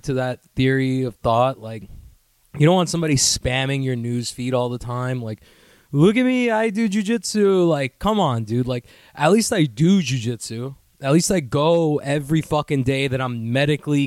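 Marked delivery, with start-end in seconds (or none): 5.9-7.7 high-pitched mock boasting; mocking show-off. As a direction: play a needy attention-seeker. Mocking attention-seeking posters.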